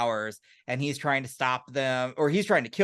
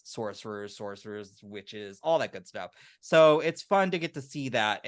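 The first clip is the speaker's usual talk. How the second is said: usual speaking voice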